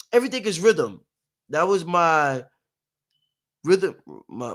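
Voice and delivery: normal talking voice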